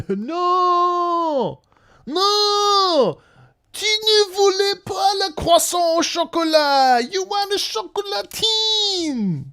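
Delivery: high-pitched wailing mock tantrum; mock outraged French. Streamer mocking French outrage over chocolatine, high wail. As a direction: wail it drawn out, far above your voice.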